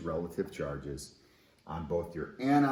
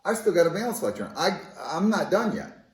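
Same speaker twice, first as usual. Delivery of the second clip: a little atom holding on to its electron; squeaky "whoa, not so fast" cartoon protest; protesting magnesium; 10 semitones higher, 8 dB louder than usual. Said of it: "Magnesium's going".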